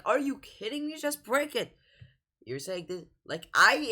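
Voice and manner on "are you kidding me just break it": high, incredulous outburst; exasperated. Real, not acted. Urges film character to break it, exasperated. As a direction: push the pitch up in exasperation.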